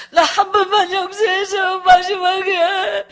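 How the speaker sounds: high, loud, slow wail; imitated sobbing plea. Husband begging wife to forgive him, loud sobbing. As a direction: bawl for forgiveness, dragging each word. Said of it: "울고 있는 소리가 계속 들리는데".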